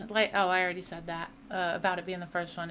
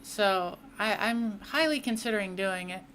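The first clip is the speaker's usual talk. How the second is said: ordinary talk, nothing marked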